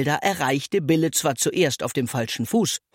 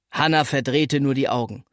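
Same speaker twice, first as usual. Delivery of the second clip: ordinary delivery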